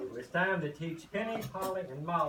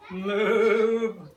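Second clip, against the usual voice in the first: loud bellowing cow voice; 4 semitones higher, 10 dB louder than usual. Acted. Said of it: "bellowed the cow".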